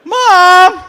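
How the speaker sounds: sudden loud high yell of Mom; shouting for mom. Acted. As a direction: call out like a scared kid. Calling out to mother on stage.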